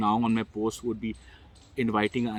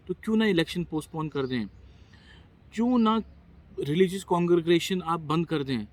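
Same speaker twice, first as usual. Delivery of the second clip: impassioned challenge; 6 semitones higher, faster than usual. Real, not acted. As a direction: burst out in exasperated appeal. Impassioned appeal to stop gatherings.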